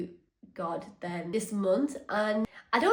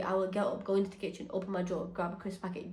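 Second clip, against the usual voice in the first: usual speaking voice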